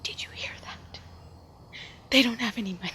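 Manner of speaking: hushed whisper, then voiced; whispering woman. Woman whispering gossip to her husband, hushed. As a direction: whisper it behind your hand. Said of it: "she whispered to her husband".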